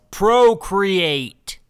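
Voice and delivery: loud, high shout; in-your-face yell. Retold or imitated. Grandfather shouting at grandson through a megaphone, in-your-face yell. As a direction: yell it right into his face. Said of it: "gets in his face and yells".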